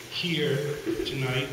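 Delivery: usual speaking voice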